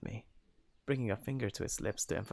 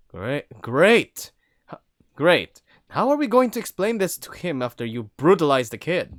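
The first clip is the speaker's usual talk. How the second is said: loud, higher sarcastic grumble; 6 semitones higher, 13 dB louder than usual. Acted. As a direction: drip with sarcasm. Sarcastic annoyed wolf.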